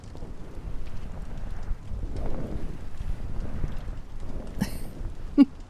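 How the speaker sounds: long, hushed giggle of joy; a breathy, happy whispered laugh; soft delighted giggle. Acted. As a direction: giggle quietly with happiness. Delighted laugh after acceptance.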